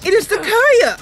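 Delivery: high, loud frantic protest; high loud desperate pleas; desperate pleading. Acted. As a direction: beg the guards not to fight. Kenlin pleads with guards.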